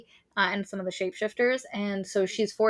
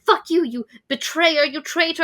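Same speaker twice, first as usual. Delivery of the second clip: mock furious; 7 semitones higher, 4 dB louder than usual. [name] super pissed, acted.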